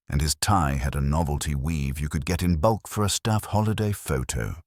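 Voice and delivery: ordinary delivery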